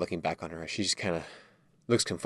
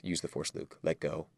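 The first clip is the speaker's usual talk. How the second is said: soft quoted line; 5 semitones lower, 12 dB quieter than usual. Retold or imitated. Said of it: "he says".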